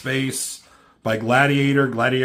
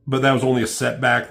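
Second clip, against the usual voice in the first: ordinary delivery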